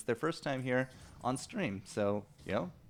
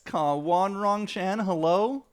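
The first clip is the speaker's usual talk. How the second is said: calling out; 9 semitones higher, 6 dB louder, slower than usual. Real, not acted. Calling out to a player.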